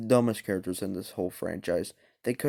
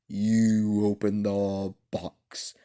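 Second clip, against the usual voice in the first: very slow, menacing Pinhead; much slower than usual. Retold or imitated. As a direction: drag it out like a horror villain. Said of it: "he's like".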